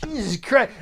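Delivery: yelp, then trail off hurt; mock pained yelp. Friend hurt, yelping again.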